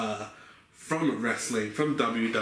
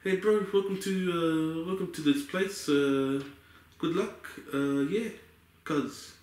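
put-on laid-back Kiwi accent, higher, slow start; easygoing Kiwi drawl, fading off; 3 semitones higher than usual. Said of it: "That's my impersonation of a, of a, a Kiwi accent".

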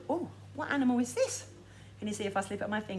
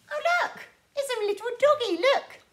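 cooing over dog; 13 semitones higher, 6 dB louder than usual. Real, not acted. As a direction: coo about the doggy. Showing dog to children.

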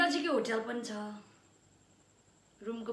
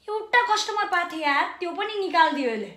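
loud, higher, aggrieved protest; angry complaint; 4 semitones higher, 5 dB louder, faster than usual. Acted. Accusing sir of chasing off the only customer, aggrieved. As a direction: complain hotly with raised voice.

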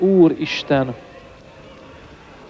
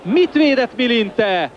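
excited; 9 semitones higher than usual. Real, not acted. Commentator reacts to great save.

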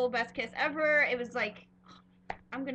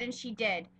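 ordinary talk, nothing marked

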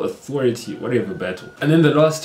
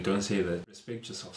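low, soft, unbothered reply; almost unfazed; 3 semitones lower, 11 dB quieter than usual. Shown as a demonstration. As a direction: brush it off quietly, calm and composed. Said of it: "You reply almost unfazed".

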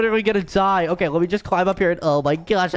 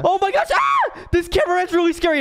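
being chased; 9 semitones higher than usual. Acted. Chased by flying cameraman.

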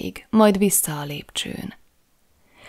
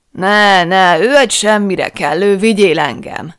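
put-on much louder, slurred drunken bawling; drunken shout; 8 dB louder than usual. A narrator's reading. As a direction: roar it like a man far gone in drink. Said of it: "kiabálta a botrányosan részeg mörtág".

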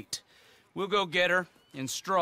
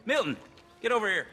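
raised voice calling across the yard; 6 semitones higher, faster than usual. Acted. Boss calling the new ranch hand over, raised call.

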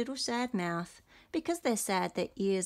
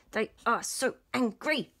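mock angry; 4 semitones higher, 4 dB louder than usual. Acting out angry face.

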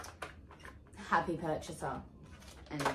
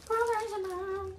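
mock posh voice; 10 semitones higher than usual.

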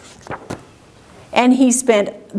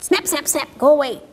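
goose snapping; 3 semitones higher, 3 dB quieter, faster than usual. Pretend. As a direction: snap lightly like a goose. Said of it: "he can say".

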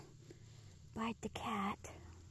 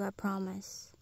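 ordinary talk, nothing marked

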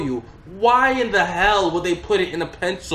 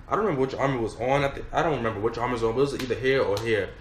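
ordinary delivery

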